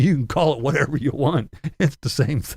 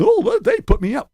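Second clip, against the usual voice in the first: mocking Tim; 6 dB louder than usual. Mocking Tim's delight at free room.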